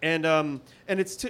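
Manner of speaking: ordinary talk, nothing marked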